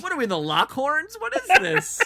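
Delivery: high, loud incredulous cry; mock exasperation. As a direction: exclaim it in comic disbelief, voice shooting up. Mock outrage at drunk antics.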